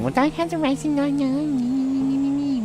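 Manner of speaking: high, squeaky mock viewer voice. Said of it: "a lot of you are saying".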